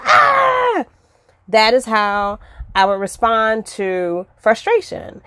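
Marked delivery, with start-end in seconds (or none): 0.0-0.8 high, short mock shriek; retold freak-out yelp, much higher; frustrated scream. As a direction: let out a burst of frustration. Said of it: "And I would just freak out".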